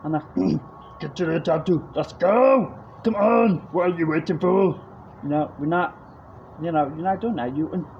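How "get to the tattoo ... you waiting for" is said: louder, barking action-hero commands; Arnold Schwarzenegger. Retold or imitated. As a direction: pump up the crowd like a muscle-bound action star. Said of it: "voice impression Arnold Schwarzenegger".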